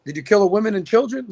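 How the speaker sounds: ordinary talk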